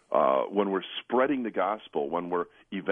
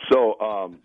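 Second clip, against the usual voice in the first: ordinary talk, nothing marked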